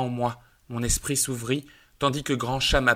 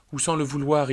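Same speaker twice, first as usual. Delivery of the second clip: ordinary delivery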